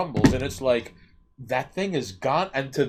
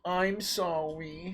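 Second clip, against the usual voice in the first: put-on drawn-out, higher-pitched babyish sorry; mock apology; 6 semitones higher, 4 dB quieter, much slower than usual. Replying to people don't like you, mock-pitiful apology. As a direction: stretch it into a cutesy fake apology.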